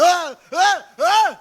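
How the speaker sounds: loud, high repeated roars; three short mock yells; Goliath's bellow. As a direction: bellow wordlessly, all noise and bluster. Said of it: "malakas lang sumigaw".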